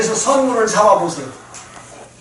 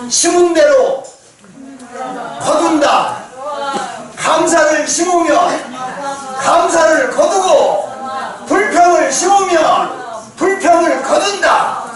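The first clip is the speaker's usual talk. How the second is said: preaching shout; 4 semitones higher, slower than usual. Shown as a demonstration. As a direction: proclaim it slowly at full voice. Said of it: "자, 다시 하겠습니다". (힘차게 천천히 선포하듯)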